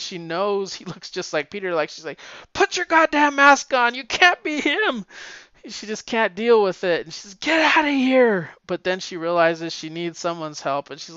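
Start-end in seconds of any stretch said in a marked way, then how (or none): 2.5-5.1 high, loud furious outburst; shrill-free high angry shout at the clone; angry yell. Retold or imitated. Mary Jane yelling at clone. 7.3-8.5 angry. Retold or imitated. Mary Jane sending clone away.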